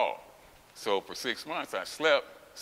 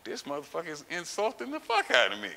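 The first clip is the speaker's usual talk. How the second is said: higher-pitched, affronted protest; retold indignation; 6 semitones higher than usual. Patient reacting to the doctor's insult, retold indignation. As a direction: let the insult sink in, pitch climbing. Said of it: "That motherfucker shouldn't have said that shit".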